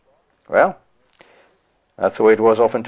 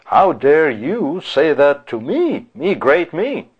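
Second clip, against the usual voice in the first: slow, higher-pitched affronted self-importance; mock indignation; 5 semitones higher, much slower than usual. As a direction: act out wounded pride, drawing the words out. Acting out offended, self-important reaction.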